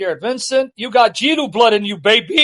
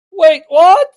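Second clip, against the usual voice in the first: loud, hammed-up indignation, slowing on dare; mock outrage; 3 semitones higher, 7 dB louder, slower than usual. Co-host pretends a friend's word offends him, in loud mock outrage. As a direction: play the offended saint, loud and slow.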